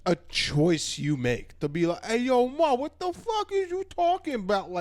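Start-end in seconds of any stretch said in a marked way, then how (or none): 2.1-4.7 high, exaggerated New York accent; mock a loudmouth New Yorker's accent. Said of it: "not everybody talks like that".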